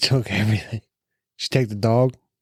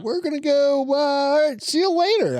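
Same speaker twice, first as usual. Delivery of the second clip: put-on high-pitched teen breakup voice; put-on high voice of a dating teen; mock teenager. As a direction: play a fifteen-year-old dumping someone, pitched way up. Mimics fifteen-year-olds breaking up.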